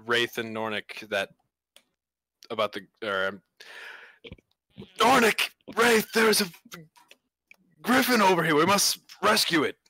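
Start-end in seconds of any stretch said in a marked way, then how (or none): 5.0-9.8 high, loud urgent shout; calling out. Acted. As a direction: shout to your companions for help. Calls companions to rescue griffin.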